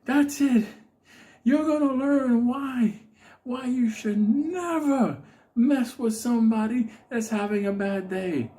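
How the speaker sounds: high, slow, near-crying threat; the Unabomber losing it. Retold or imitated. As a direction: threaten while about to break down. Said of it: "He's like".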